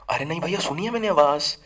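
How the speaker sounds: high-pitched insistent protest; acted kid brother insisting.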